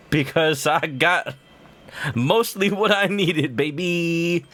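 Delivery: smug gloat. Real, not acted. Gloating over cards.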